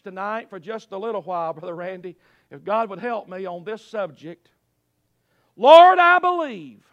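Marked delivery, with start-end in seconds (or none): 5.6-6.9 high, loud proclamation; loud cry of faith. Acted. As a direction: call out boldly to God. Preacher cries out the text.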